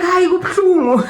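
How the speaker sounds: high whining protest; whiny complaint. Retold or imitated. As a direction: whine that it hurts, half-joking. Her retold cry of pain.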